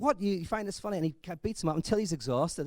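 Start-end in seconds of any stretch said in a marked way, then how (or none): 0.0-1.0 mock angry disbelief. Tough guy confronts laughing man.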